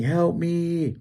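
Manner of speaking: high, stretched mock cry for help; imitated yell. Man retells yelling to teenagers across the river, high mock yell. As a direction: call across the water without real volume. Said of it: "but I'm yelling".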